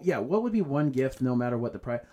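normal talking voice

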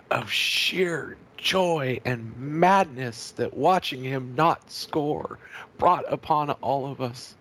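high, slow, grandly mournful; mock solemn eulogy.

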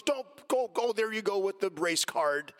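high, exasperated imitation of a retort; retold dismissive officer. Officer dismissing driver's argument about being shot, exasperated retort. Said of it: "And he goes".

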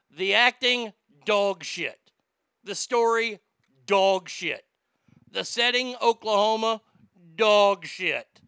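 loud, punchy rant; 1 ranting verdict. Real, not acted. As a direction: slam each flaw down hard. Ranting list of movie flaws.

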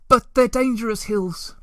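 sudden high blurted warning; bursting out. A narrator's reading. Moomintroll suddenly warns the Hemulan off, high blurted outburst. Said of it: "he broke out".

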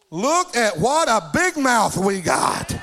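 slow, drawn-out loud exclamation; hammed-up marvelling at the catch; showman's holler. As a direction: holler it out, stretching every word. Hollering over a fish shown.

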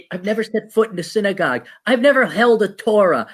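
ordinary delivery

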